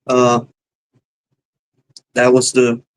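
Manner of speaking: ordinary talk, nothing marked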